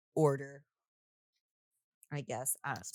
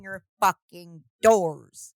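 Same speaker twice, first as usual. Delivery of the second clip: loud, slow, word-by-word exasperation; exasperated emphasis; 12 dB louder, much slower than usual. Real, not acted. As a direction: hammer out each word loudly. Exasperated rant about unlocked doors.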